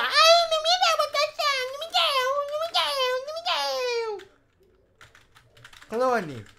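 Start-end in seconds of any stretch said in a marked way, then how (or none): 0.0-4.3 mocking whiny players. Host imitating players moaning about points, mock whining. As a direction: mimic complainers in a squeaky sing-song wail. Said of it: "se eles não ganham pontuação, fica lá".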